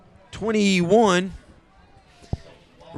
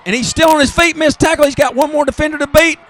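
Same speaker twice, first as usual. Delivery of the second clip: much higher and much louder, near shouting, as the runner breaks free; excited shouting call; 7 semitones higher, 8 dB louder than usual. Real, not acted. Announcer calling the long run to the stands, shouting with excitement. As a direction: yell it like the runner is about to score.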